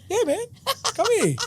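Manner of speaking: acted-out eager acceptance; delighted welcome. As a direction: welcome him in for a hug. Welcoming a client's hug request.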